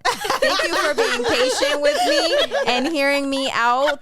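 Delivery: high, loud laughing thanks; hammed-up grateful guru, laughing loudly; laughing shout. Real, not acted. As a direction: proclaim it through a laugh. Laughing mock proclamation.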